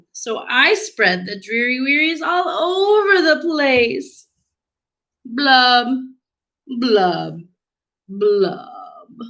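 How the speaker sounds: stretched-out, sing-song storybook lament; gloomy pout-pout fish. Acted. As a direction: drag the opening, then sink through the blubs. Acts the fish's refrain.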